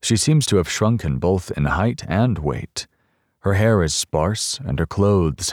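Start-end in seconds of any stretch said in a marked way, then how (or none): none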